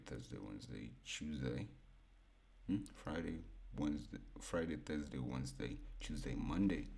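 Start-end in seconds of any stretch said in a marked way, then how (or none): none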